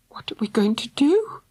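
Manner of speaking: breathy frightened whisper; whimpering. A narrator's reading. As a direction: whimper it in fear. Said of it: "whimpered Missus Thunder".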